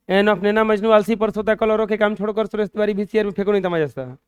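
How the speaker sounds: fast recitation. Shown as a demonstration. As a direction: rattle the whole mnemonic off quickly. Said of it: "अब इसको गिना जा सकता है". (तेज़ी से रटा हुआ पाठ)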